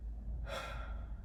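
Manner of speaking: soft, weary exhale; long quiet sigh. Real, not acted. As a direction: let out a long, soft breath. Sighs after upsetting story.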